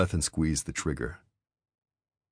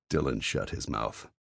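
usual speaking voice

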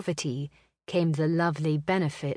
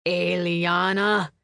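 slow, gruff brother; gruff warning; 4 dB louder, slower than usual. Acted. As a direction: draw her name out gruffly. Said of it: "his voice gruff".

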